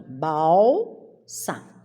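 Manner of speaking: "balsa" slowly split into bal, sa; syllable by syllable. Shown as a demonstration. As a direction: hold bal, then a separate sa. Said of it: "eu separo aqui".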